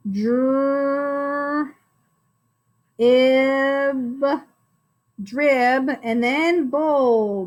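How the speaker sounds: "Dr-, ib" held long and apart, then joined as "drib"; sounding out. Shown as a demonstration. Teacher checks dribble with pupils, sounding out. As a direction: stretch each sound of "drib", then add "bowl". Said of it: "All right, let's check it".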